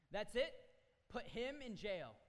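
furious shout to throw him in jail; angry king. Acted. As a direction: bellow the sentence as an enraged king. King orders man jailed.

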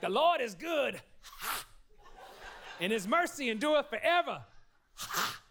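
high, loud preaching with gasped "ha"; old-school preacher. Retold or imitated. Pastor imitating preachers he grew up with, hammed-up preacher voice. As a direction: imitate a fired-up preacher punctuating each breath. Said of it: "when the preacher would really start get going".

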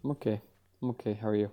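muffled, dull sound. Real, not acted. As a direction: voice muffled like through cloth. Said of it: "You sound muffled".

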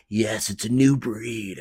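slow, stretched announcer reply; movie trailer voice. Acted. Trailer guy explains to listener, in movie trailer voice. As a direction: answer as a grand trailer narrator. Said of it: "The movie trailer voice?".

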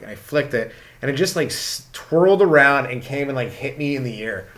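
ordinary talk

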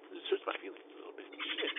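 soft whispered admission; whispered hurt aside. Real, not acted. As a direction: breathe it out, wounded. Admitting hurt to himself.

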